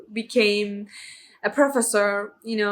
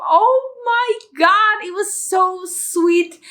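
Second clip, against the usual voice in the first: high, slow, swooning oh my God; gushing; 9 semitones higher, slower than usual. Real, not acted. Reviewer swooning over the couple's yellow cardigan scene, gushing. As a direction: melt over how sweet it is.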